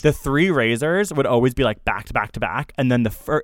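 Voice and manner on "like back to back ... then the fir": ordinary delivery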